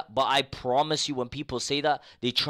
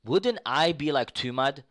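normal talking voice

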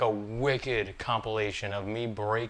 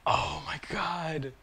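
stunned whisper; 2 semitones higher, 6 dB quieter, much slower than usual. Real, not acted.

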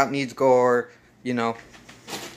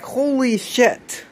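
drawn-out, higher-pitched shock; stunned disbelief; 8 semitones higher, much slower than usual. Acted. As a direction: stretch it out like a stunned viewer. Acts out viewer's shocked reaction.

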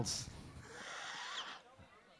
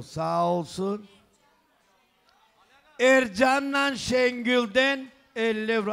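booming wedding announcer; 3 semitones higher, 4 dB louder than usual. Acted. Wedding gift money announcement.

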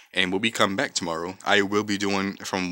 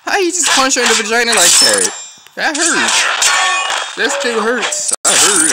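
outraged yell; 12 semitones higher, 8 dB louder than usual. Real, not acted. Outraged at the game move.

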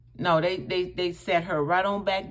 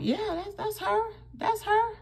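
retold high confirmation; 3 dB quieter than usual. Confirming to shocked friend.

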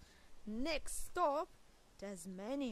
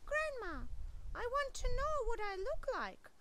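high, pleading small voice; a small child begging grandma, high; little possum voice; 11 semitones higher, 5 dB louder than usual. Acted. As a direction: beg like a tiny possum. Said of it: "Hush said".

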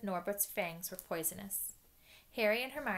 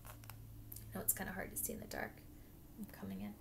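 whisper; 10 dB quieter than usual. Real, not acted. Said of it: "recorder whispering aside".

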